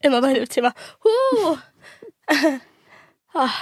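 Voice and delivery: sniffling. Real, not acted. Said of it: "ich wollte nicht heulen".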